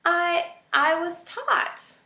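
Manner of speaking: high, slow, meek stammer; timid. A narrator's reading. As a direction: answer meekly. Poppy timid.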